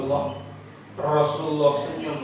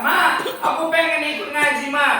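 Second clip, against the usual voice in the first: put-on high begging child voice; child pleading; 9 semitones higher, 5 dB louder than usual. Child begging mother to go to Quran class, high plea. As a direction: whine to mom like a kid.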